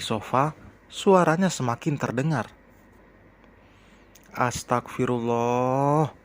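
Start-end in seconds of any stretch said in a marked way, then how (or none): 4.4-6.1 drawn-out startled exclamation. A narrator's reading. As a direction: stretch it out slowly in alarm. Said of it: "Hana terlonjak kaget".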